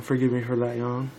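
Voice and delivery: plain reading voice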